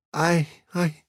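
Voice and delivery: high, faltering sob; sobbing. A narrator's reading. Missus Phelps breaks down after the poem, sobbing. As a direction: stammer it through tears. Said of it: "sobbed Missus Phelps".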